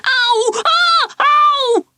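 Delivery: loud, very high pained yelps. Acted. Ralph yelling in pain as the mouse bites him. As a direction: cry out as bitten. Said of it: "yelled Ralph".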